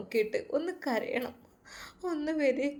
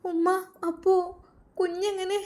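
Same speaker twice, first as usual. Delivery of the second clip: surprised; 8 semitones higher than usual. Acted. Daughter puzzled about the baby.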